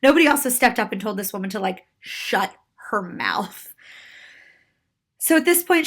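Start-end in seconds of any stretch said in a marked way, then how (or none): 2.0-3.7 slow, whispery, pointed words; hushed emphasis. Real, not acted. Storyteller wishes bystanders had intervened, slow hushed emphasis. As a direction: drop to a slow near-whisper.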